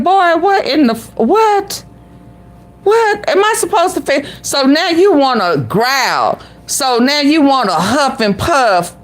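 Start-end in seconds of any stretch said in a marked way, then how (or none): 0.0-4.3 high indignant outburst, "what" thrown up high; imitating a woman snapping back at him in disbelief; outraged retort. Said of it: "I would have said".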